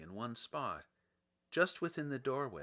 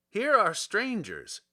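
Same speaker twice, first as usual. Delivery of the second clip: slow, raised and louder, announcing the visitors; gatekeeper announcing; 6 semitones higher, 7 dB louder, slower than usual. A narrator's reading. Gatekeeper presents strangers to the soldier, slow and raised. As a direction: proclaim it like a gatekeeper at the door. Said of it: "said the guardian of the gates to him".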